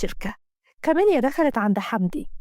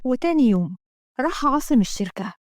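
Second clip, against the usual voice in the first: ordinary delivery, nothing marked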